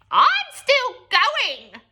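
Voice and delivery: high, loud, slow screech; screechy old voice. A narrator's reading. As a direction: screech it from above. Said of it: "came the old screechy voice".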